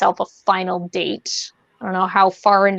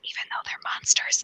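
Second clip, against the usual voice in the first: whisper; 11 dB quieter than usual. Retold or imitated. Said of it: "they're like".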